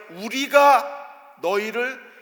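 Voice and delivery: 우리가 drawn out slow and high; stress on 우리가. Shown as a demonstration. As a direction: lean on 우리가 as if Moses claims the deed. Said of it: "큰 소리를 내고 있어요". (우리가를 높고 길게 강조)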